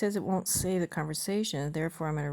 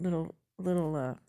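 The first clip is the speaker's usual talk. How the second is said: ordinary talk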